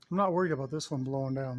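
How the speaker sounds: ordinary talk, nothing marked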